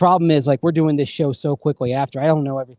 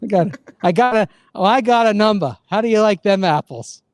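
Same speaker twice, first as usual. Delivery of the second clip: put-on higher, cocky movie Boston accent; 5 semitones higher, 4 dB louder than usual. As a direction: taunt with a Boston accent, dropped R in number. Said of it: "a Boston accent from, uh, Good Will Hunting".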